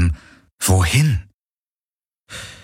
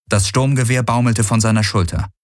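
ordinary delivery, nothing marked

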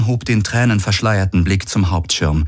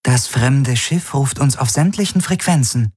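higher, even computer report; ship computer; 8 semitones higher than usual. A narrator's reading. The ship's AI reports the incoming hail to Galen, in a higher computer voice. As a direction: announce it as the ship's system. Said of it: "meldete die Vago".

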